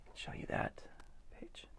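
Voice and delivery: hushed whisper aside. Real, not acted. Reading to a child, whispering before showing the page. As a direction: whisper it as you turn the page.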